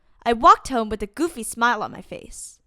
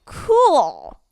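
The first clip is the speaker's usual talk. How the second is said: louder, awestruck "Cool." with a falling tune; dazzled cool; 3 semitones higher, 6 dB louder than usual. A narrator's reading. Narrator in awe of the best jumper, dazzled. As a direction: say it like they're the coolest ever. Awed reaction to cool beans.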